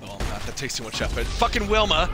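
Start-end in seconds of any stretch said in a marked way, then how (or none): 1.2-2.1 loud, higher-pitched burst of annoyance; sudden irritated yell; exasperated cursing. Real, not acted. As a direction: curse the opponent out loud. Cursing an opposing player.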